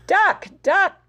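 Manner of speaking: high urgent call. Acted. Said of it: "cried Hen".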